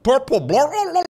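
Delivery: high gurgling creature babble; Murloc. Retold or imitated. Streamer mocking the tongue-twister attempt, Murloc gurgle. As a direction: let the r's collapse into a high warble. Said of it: "You sound like a Murloc".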